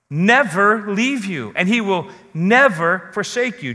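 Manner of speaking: heavy, deliberate weight on every "never"; stress on never. Shown as a demonstration. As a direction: stretch and punch each "never". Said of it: "Emphasis on the word never".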